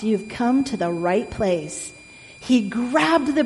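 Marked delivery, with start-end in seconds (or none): none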